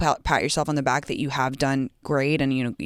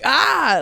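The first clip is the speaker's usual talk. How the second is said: mock scream; 10 semitones higher, 7 dB louder than usual. Mock fright at seeing ex.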